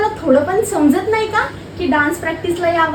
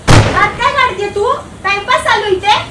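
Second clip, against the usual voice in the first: loud and higher, slowed scolding; yelling; 4 semitones higher, 9 dB louder than usual. Acted. Teacher yells at a student wasting time in practice. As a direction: yell at the girl fooling around.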